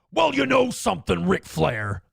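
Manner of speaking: promo voice starting high and dropping on the name. Acted. Comedian shows he only has promo mode, wrestler impression. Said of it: "like, promo [name]".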